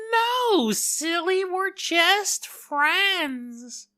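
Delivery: put-on squeaky high-pitched girly voice; a high, slow giggly denial; high teasing friend. Friend teasing back in a high squeaky voice.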